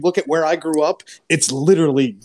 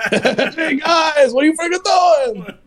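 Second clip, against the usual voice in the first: high, shouting Jersey guy; Carl from Aqua Teen; 13 semitones higher, 4 dB louder, slower than usual. Retold or imitated. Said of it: "I just became Carl from Aqua Teen".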